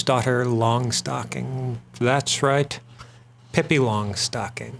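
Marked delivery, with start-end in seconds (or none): none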